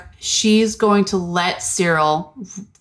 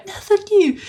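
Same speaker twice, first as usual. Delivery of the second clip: high mock squabble; 13 semitones higher than usual. Parody quarrel repeated.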